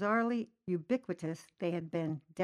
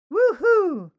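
a loud, high, giddy woo-hoo; ecstatic whoop; 13 semitones higher, 16 dB louder than usual. Acted. Stoned driver whooping at the imaginary parade, loud and high. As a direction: whoop like he's seen the best parade ever.

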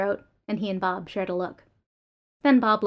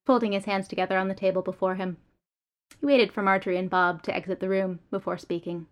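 ordinary delivery

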